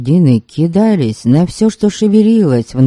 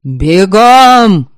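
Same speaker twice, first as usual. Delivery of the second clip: loud drawn-out shout; yelling; 5 semitones higher, 7 dB louder than usual. Acted. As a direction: bellow it across the field. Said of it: "орал Верем".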